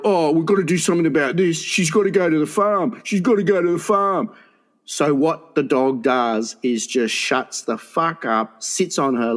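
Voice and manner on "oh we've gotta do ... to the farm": a lightly voiced worried owner, quick at first; owner Teddy. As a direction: do Teddy deciding the dog must go. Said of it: "Teddy is going to fucking go".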